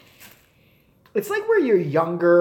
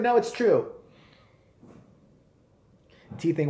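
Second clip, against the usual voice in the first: ordinary talk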